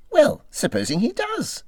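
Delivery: high, eager little Mole voice; a small creature pitching a hopeful idea. Acted. Mole pleading with Rat to invite Badger, high and eager.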